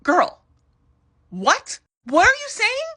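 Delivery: high, loud incredulous squeal; shocked disbelief. Real, not acted. Recapper reacting to Eileen's remark, shocked. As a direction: react like you can't believe your ears.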